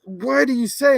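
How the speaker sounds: high, loud imitation of an all-caps commenter; mock angry yelling. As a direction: mimic a furious demand for an explanation. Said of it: "you can tell he's mad".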